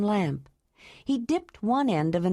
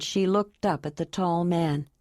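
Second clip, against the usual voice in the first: plain reading voice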